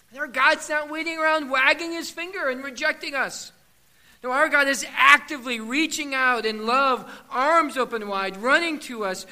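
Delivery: loud, rising preacher's build; the pastor urging God is reaching out; preaching at full force. Acted. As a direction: swell with passion. Preaching build on God's love.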